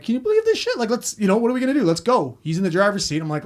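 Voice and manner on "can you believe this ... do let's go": high-pitched, agitated urging; urgent disbelief. Retold or imitated. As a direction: plead to get moving, voice shooting up. Urging frozen partner to leave.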